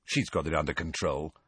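low, soft mutter. A narrator's reading. Ron aside to Harry, muttering. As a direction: mutter it under your breath. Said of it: "then muttered to Harry".

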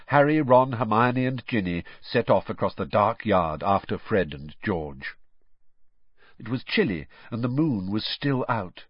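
ordinary delivery

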